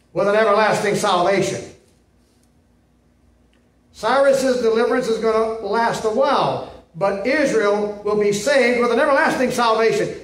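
loud, ringing pulpit delivery; forceful preaching. Acted. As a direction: proclaim it to the congregation. Preaching scripture at full force.

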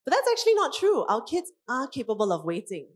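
ordinary talk

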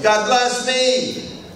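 high, slow and stretched out; high drawn-out. Shown as a demonstration. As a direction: draw it out way up high. Joke repeating blessing to self.

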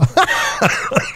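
high-pitched burst of laughter. Real, not acted.

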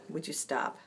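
ordinary talk, nothing marked

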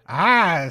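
loud, drawn-out hiss; long spider hiss. Acted. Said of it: "lets out a long hiss".

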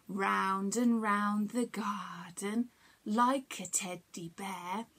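slow whisper, round and round the garden; hushed tickle rhyme. Acted. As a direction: whisper it slowly to build suspense.

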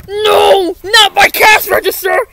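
loud pleading wail; desperate cry. Acted. Mister Krabs begging them to spare his register, loud wail. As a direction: beg loudly, voice cracking.

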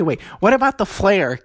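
ordinary talk

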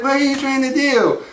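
high puzzled nerd voice; Steve Urkel. Acted. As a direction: ask it as a baffled sitcom nerd. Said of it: "And Urkel's like".

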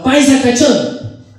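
high, slow drawn-out jeer; shouted taunt. Acted. Pastuso yelling at the paisa leaving for work, taunting. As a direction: holler it down to the street. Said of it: "le gritaba".

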